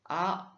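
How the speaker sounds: Mandarin "A" wide open and loud. Shown as a demonstration. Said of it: "on va commencer par A".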